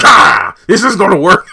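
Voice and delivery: hammed-up roar of desperate effort; mock lunging yell. Reviewer mimics thrusting himself forward at someone, loud hammed-up yell. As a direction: burst out very loud and high.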